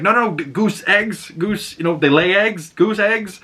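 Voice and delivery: high-pitched pleading "no, no"; pleading cook. Retold or imitated. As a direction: squeak it out like a panicky cook. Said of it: "and he's like".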